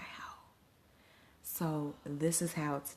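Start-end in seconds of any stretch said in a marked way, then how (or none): 0.0-0.3 whispered, breathy wow; awed wow. Retold or imitated. Telling viewers her coach walked in, awed whisper. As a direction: let it out in a stunned whisper. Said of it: "it was like".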